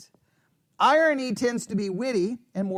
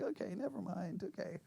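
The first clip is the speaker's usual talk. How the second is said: dropped, resigned never mind under the breath; deflated sigh of giving up; resigned mutter; 18 dB quieter than usual. Retold or imitated. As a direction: give up on the joke quietly. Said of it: "And you're like".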